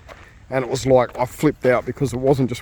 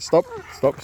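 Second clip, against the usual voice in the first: firm tone. Real, not acted. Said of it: "I've got to change my tone".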